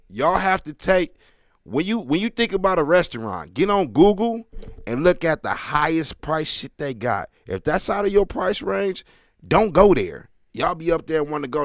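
loud, emphatic advice; loud ranting. Acted. Comic lecturing the crowd, loud rant. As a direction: hammer the advice home.

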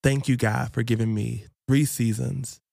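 slow, low and soft thanksgiving; prayer. Real, not acted. Host thanking God for three seasons, quiet and slow. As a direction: close the prayer gently.

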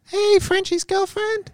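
very high, slow falsetto; high-pitched girlfriend impression. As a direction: squeak it out as a mock girlfriend. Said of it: "My girlfriend's got quite a high-pitched voice".

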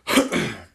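harsh throat clearing mid-cough; coughing fit. Real, not acted. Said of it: "I hate coughing like this".

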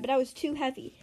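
ordinary delivery, nothing marked

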